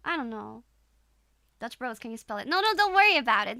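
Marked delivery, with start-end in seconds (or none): 2.5-3.6 higher, louder, fast brush-off; flustered. Real, not acted. Streamer dodging a viewer's question, flustered. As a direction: hurriedly wave the question away.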